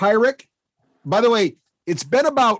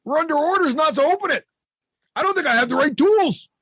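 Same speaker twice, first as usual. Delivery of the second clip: nervous goblin; 11 semitones higher than usual. Acted. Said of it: "He's like".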